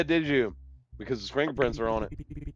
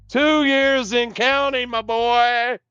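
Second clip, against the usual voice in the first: much higher, loud gloating judge; 13 dB louder than usual. Acted. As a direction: rub the sentence in, my boy. Judge gloats over sentence.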